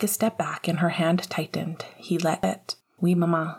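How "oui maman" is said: plain reading voice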